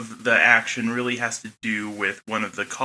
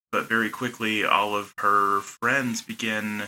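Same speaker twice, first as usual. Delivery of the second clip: usual speaking voice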